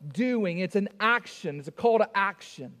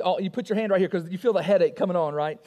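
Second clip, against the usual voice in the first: ordinary talk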